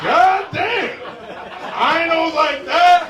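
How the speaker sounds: very high, loud astonishment; startled exclamation. Real, not acted. Comedian reacts to the room, loud high astonished shouting. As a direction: shout it out, taken aback.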